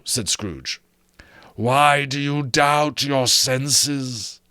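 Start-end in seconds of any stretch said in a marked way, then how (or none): 1.5-4.4 high, very slow, eerie ghost; slow ghostly voice. Acted. Marley's ghost challenging Scrooge, slow and eerie. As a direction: draw it out from beyond the grave.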